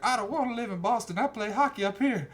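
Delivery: high-pitched mock teen protest; whiny teenage nephew. Nephew refusing to move to Boston, whiny teen voice. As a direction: whine it high like a stubborn kid.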